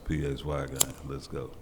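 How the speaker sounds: ordinary talk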